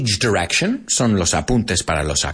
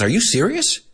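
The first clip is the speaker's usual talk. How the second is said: much higher, acted incredulous question; high-pitched disbelief; 12 semitones higher than usual. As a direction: lift it high, playing astonished. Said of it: "I'll say it in that high-pitched voice".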